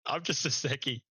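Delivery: a hint of a flustered guard, mildly voiced; panicky security guard. Security guard who doesn't know what to do, a touch of mock panic. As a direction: lightly play the nervous guard who's out of his depth. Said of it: "he was panicking".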